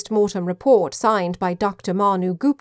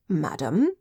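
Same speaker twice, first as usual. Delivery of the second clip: lower, softer butler's query; elderly butler; 5 semitones lower, 4 dB quieter than usual. A narrator's reading. Butler appearing when beckoned by Ginger, elderly butler. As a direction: answer a summons quietly, rising at the end.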